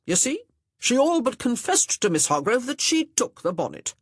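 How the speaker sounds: pompous, accusing gentleman's voice; accusing young gentleman. A narrator's reading. Augustin questioning Honor about the bonnet, accusing. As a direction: press the charge with mock authority.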